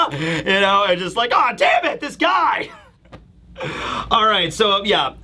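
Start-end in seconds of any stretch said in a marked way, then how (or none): 1.3-2.7 high mock-exasperated cry; exasperated outburst. Reacting to the villain turning up, exasperated cry.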